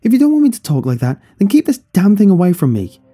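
high, loud furious outburst; angry shouting back. A narrator's reading. Son to mother about the doll, angry shout. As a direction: raise the pitch and volume, fed up.

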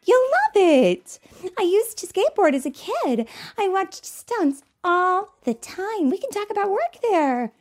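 high, loud, coaxing; eager Rookie. Acted. Rookie coaxes gruff Sam to come, high and eager. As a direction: talk him into it with a rush of enthusiasm.

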